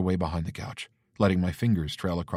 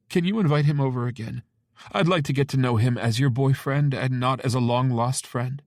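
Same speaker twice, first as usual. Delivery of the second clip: tearful mother; 6 semitones higher, 5 dB louder than usual. Acted.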